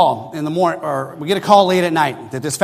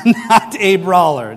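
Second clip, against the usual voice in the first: slow, amused, laughing through it; real laughter, slowed down; laughing to himself; slower than usual. Real, not acted. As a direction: chuckle out the words at yourself. Laughing at his own realisation.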